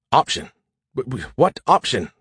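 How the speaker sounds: stammering disbelief; sputtering. Acted. AI reacting to talk of an option, sputtering. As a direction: trip over the first word, incredulous. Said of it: "Skippy sputtered".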